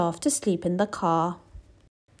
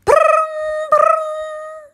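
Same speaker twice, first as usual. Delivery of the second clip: very high, louder buzzing brrr; alarm ringing; 8 dB louder, much slower than usual. Acted. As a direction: ring like an alarm clock. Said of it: "went the alarm clock".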